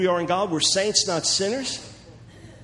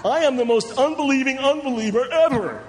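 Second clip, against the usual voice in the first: high, louder, laughing mockery; mock confession, laughing; 6 semitones higher, 3 dB louder than usual. Preacher laughs mocking a believer's gloomy confession, high and louder. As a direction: laugh it out as absurd.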